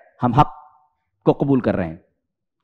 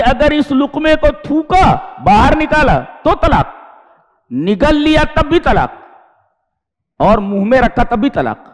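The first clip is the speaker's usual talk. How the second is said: retold threat pitched higher, a touch of anger; angry husband; 6 semitones higher than usual. Teacher retelling the angry husband to students, mild mock anger. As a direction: imitate the husband's heated ultimatum. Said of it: "غصے میں تھا".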